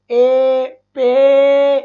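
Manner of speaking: ए and पे loud, vowel held long; teacher isolating the sound ए, drawn out. Shown as a demonstration. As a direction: stretch the vowel in "पे" loudly so it stands out. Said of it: "तो ए की sound भी आ रही है".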